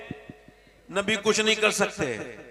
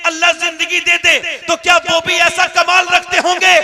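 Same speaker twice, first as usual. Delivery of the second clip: loud, high, rousing preacher voice; booming political rally speech; 9 semitones higher, 9 dB louder than usual. Acted. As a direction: build the crowd up, preaching loudly. Preacher builds to a booming climax. (ऊँची बुलंद तक़रीर की आवाज़)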